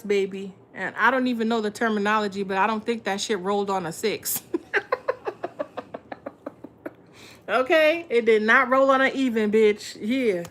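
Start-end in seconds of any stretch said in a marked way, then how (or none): 4.5-6.9 high airy wheeze of a laugh; a high breathy giggle; breathless laugh. Real, not acted. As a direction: cackle silently, all breath. Laughing at tarot card.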